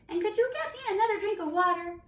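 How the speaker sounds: high, loud, quick request; eager young dragon. Acted. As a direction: chime in as a small dragon. First dragon asks for more.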